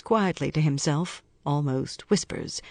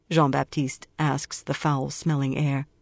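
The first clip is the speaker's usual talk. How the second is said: usual speaking voice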